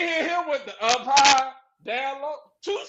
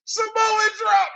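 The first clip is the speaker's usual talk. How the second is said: mock announcer call; 7 semitones higher, slower than usual. Host showing how the move is normally called out. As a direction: drag out Samoan drop. like a ringside call. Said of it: "like they said".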